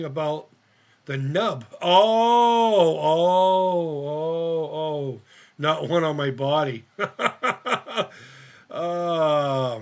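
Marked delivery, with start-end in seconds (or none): 1.8-5.2 loud pained oh, fading out; pained wail. Real, not acted. Pained at the thought. 6.9-8.4 big laugh. Real, not acted. Laughing at the nub.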